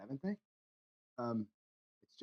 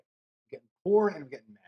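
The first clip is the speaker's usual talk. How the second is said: getting mad; 7 dB louder than usual. Real, not acted. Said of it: "Now I'm just getting mad".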